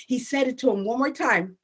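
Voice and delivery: ordinary delivery, nothing marked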